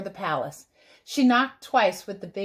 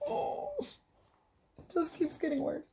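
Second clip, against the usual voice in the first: sympathetic aside; 6 semitones higher, 10 dB quieter, slower than usual. Acted.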